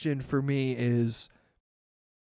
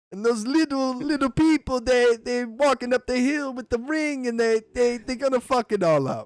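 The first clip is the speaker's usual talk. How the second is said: mock orc; 6 semitones higher than usual. Orc voice complaining.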